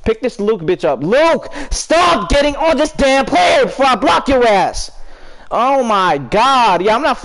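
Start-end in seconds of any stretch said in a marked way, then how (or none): 1.0-4.9 angry yell at teammate. Real, not acted. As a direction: bark it at the teammate, drawn out. Yelling at teammate over mic.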